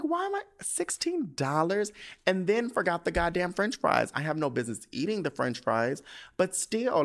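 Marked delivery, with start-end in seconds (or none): 0.0-1.9 very high squeal of disbelief; hammed-up price shock; incredulous. Outraged at the burger's price.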